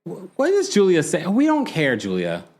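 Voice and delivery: dismissive. Retold or imitated. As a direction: wave her off with mock indifference. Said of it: "I'm like".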